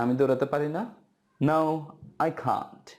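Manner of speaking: ordinary talk, nothing marked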